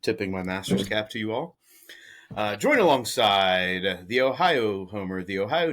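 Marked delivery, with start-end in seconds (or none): none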